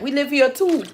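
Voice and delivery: high-pitched put-on Jamaican accent. Imitating Jamaicans answering back, high Jamaican accent. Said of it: "how I'm doing on my accent".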